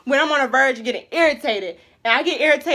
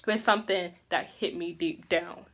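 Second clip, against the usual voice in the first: normal talking voice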